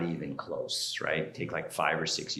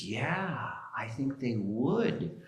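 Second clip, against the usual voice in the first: higher, drawn-out reply; drawn-out agreement; 5 semitones higher, much slower than usual. Retold or imitated. Girl answering whether anybody would fake it, drawn-out agreement. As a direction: stretch the agreement out slowly.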